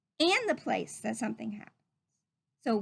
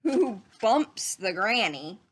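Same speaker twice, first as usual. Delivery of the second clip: slow, louder dramatic reading; building storybook suspense; 4 dB louder, slower than usual. Acted. Teacher reading the chaotic ending to the class, slow and dramatic. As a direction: build each line like a chain of mishaps. Dramatic climax of the read-aloud.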